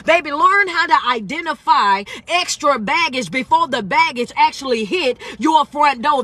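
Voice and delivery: motivational preaching. Real, not acted. Coach telling viewers to take accountability, motivational preaching. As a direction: preach it in punchy, emphatic, building phrases.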